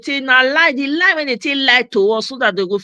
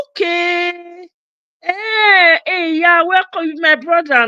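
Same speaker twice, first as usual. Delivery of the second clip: delighted welcome; 7 semitones higher, slower than usual. Real, not acted. Greeting fellow Edo man.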